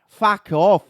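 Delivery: cussing in broad Australian; Australian accent. Shown as a demonstration. Host parodying Australians, loud Aussie accent. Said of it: "That's how they fucking talk".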